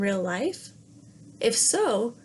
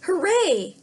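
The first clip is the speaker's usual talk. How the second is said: much higher, loud cheer; joyful cheer; 9 semitones higher, 5 dB louder than usual. Acted. Princess cheers getting her ball back, high and loud. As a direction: shout for joy at the ball. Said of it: "she cried".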